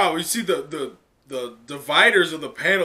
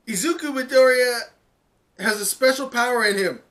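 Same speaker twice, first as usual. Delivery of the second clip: much higher, loud proclaiming call; loudspeaker announcement; 9 semitones higher, 6 dB louder than usual. Acted. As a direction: announce it to the whole crowd. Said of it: "she makes an announcement".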